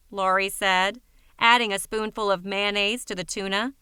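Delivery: usual speaking voice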